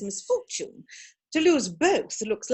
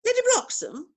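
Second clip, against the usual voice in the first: haughty surprise; 12 semitones higher than usual. Acted. Disdainful query of unknown lady.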